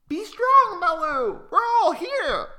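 very high and bright encouragement; encouraging chorus bird. Acted. Weavers encouraging Malu before the bullies arrive, very high. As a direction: cheer her on from the branches.

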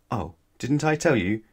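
usual speaking voice

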